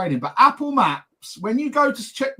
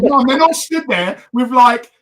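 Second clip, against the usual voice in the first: louder, slowed exasperated plea; exasperated retort; 3 semitones higher, 8 dB louder than usual. Retold or imitated. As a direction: raise your voice in disbelief.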